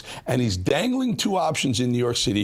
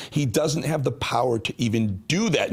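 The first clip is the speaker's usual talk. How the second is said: normal talking voice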